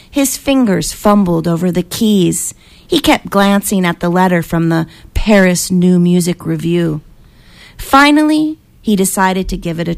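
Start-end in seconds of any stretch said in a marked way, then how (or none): none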